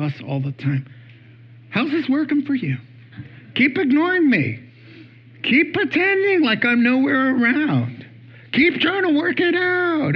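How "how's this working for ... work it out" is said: high-pitched, pointed teasing; mock-patient challenge, pitched up; wry divine chiding. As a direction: needle them as an exasperated God. Voices God chiding the disciples.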